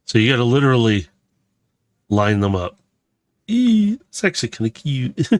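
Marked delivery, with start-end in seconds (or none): 3.5-4.0 high, loud little squeal of delight. Real, not acted. Building a toy, delighted by a cute part: high squeal.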